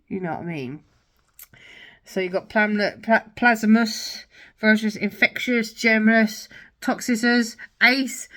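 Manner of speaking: ordinary talk, nothing marked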